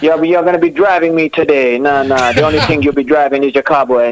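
Jamaican accent. Shown as a demonstration. Guest sledging a batsman, playful Jamaican accent.

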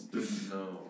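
sarcastic air quotes around the words. Shown as a demonstration. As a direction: mark didn't know as ironic, in air quotes. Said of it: "Finger quotes".

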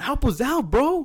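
high, loud retold appeal; mock plea for help. Acted plea for help in story.

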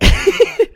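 high breathy burst of laughter; his own laugh. Real, not acted. After saying he hates his laugh, a high burst of laughter. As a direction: let the laugh he hates slip out. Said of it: "there it is".

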